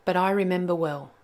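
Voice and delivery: put-on lively read-aloud for children; story narration. A narrator's reading. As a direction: tell it like a bedtime tale.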